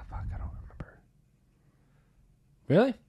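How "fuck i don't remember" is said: under-the-breath mutter; frustrated hushed aside; quick whispered curse. Real, not acted. Forgetting mid-story.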